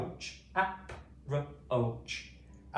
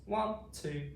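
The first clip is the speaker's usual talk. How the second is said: normal talking voice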